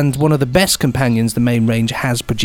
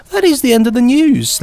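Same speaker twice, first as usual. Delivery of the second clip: high mock-grand announcer voice; mock newsreader sign-off; 10 semitones higher, 3 dB louder than usual. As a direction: sign off the news like a pompous anchor.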